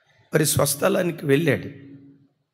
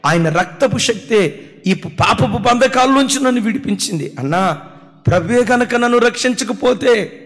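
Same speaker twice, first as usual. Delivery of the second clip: higher, louder fervent preaching; full-force preaching; 8 semitones higher, 8 dB louder than usual. Acted. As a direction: pour it out to the congregation with mounting force. (ఉద్రేకంతో బిగ్గరగా ప్రసంగం)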